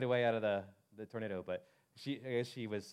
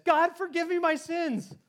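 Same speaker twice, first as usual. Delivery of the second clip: high, loud, slow desperate plea; wife praying in the truck as the tornado nears, frantic; retold panicked prayer; 7 dB louder, slower than usual. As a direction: cry to God as the tornado closes in. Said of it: "She says".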